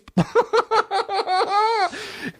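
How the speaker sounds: very high-pitched burst of laughter; real laugh. Real, not acted. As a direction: crack up mid-story. Cracks up during story.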